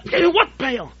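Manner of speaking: loud, high, demanding bark; guard's angry shout. Retold or imitated. Guard questioning a dancing prisoner, loud and indignant. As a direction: bellow the question like an outraged guard.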